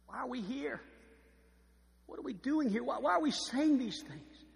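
the Spirit asking why we are here; voicing the Holy Spirit.

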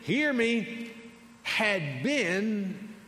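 loud, high, emphatic scripture reading; forceful preacher's delivery; full-force preaching. Real, not acted. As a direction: drive the verse home to the congregation.

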